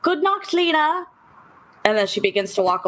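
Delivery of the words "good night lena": sugary high goodbye; sweet farewell. Acted. Elsa bidding Lena a sugary high good night. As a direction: chirp it brightly up high. Player voices character's farewell.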